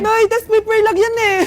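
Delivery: loud, high-pitched pleading; panicked protest. Acted. Child protests during mother's rage.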